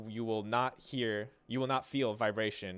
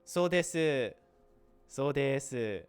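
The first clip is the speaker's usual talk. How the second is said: lightly higher, cutesy so desu; feminine character voice; 4 semitones higher, 4 dB louder, much slower than usual. Acted. Teacher imitating a feminine character saying so desu. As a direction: play a cute feminine anime girl saying so desu. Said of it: "super feminine character".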